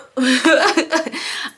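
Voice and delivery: high sheepish laugh; laughing burst. Real, not acted. Laughing at quitting Hardee's for Pizza Hut, sheepish laugh. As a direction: let out an embarrassed laugh. Laughs at her own departure.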